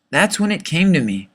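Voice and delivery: usual speaking voice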